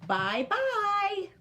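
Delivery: little bear calling out high and squeaky. A narrator's reading. Smallest snow bear calls goodbye to Aloki, high and squeaky. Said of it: "a high squeaky voice calling to her".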